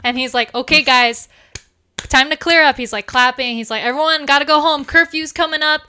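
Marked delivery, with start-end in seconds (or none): 0.6-2.8 raised, higher voice to the room; calling out. Acted. Sheriff clears the pub, clapping. 3.8-5.8 high, loud, hurried announcement; calling out. Acted. Sheriff sends drinkers home.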